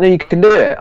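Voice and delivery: retold insistence. Trainer telling carb-fearing people it's allowed, insistent. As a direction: insist eagerly, voice lifted.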